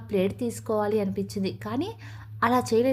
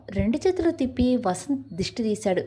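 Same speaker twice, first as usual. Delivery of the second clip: ordinary delivery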